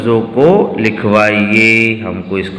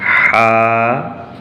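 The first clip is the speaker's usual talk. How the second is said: slow, long drawn Haji; slow dictation; much slower than usual. Shown as a demonstration. Teacher dictating Haji to pupils, slowly stretched. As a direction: draw Haji out slowly for the class to copy. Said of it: "हमको भी यहाँ लिखना है".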